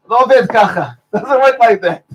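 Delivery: laughing through the punchline; amused laugh breaking into the words. Real, not acted. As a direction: chuckle as you say it. Laughing at his own joke.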